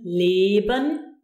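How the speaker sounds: Leben with a held "lay" vowel; long closed E. Shown as a demonstration. Teacher explaining long E to learners, Leben with E held. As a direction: stretch the first E of Leben. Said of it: "the long closed version".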